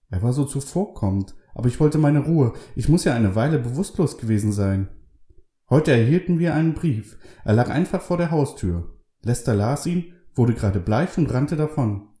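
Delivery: high, lively woman's voice; Carol's voice. A narrator's reading. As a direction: pitch up for the pious wife.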